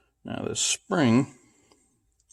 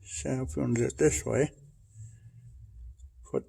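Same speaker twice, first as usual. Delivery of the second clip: flashlight in mouth; 4 dB quieter than usual. Real, not acted. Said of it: "I got the flashlight in my mouth".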